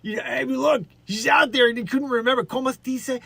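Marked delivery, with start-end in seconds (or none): 2.4-3.2 mock Spanish accent. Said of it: "She goes".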